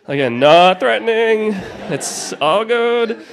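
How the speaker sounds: ordinary talk, nothing marked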